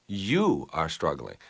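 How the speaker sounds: slow, weighted YOU opening the reply; stress on YOU. Shown as a demonstration. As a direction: lean long on the word You. Demo: respond with you.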